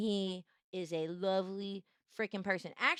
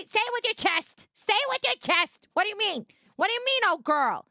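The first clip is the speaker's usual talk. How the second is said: loud, higher, playfully demanding; mock confrontation; 9 semitones higher, 8 dB louder than usual. Streamer calling back a teasing viewer, loud mock challenge. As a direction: call the viewer back in jest.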